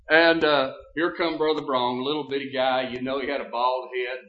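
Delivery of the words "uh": ordinary talk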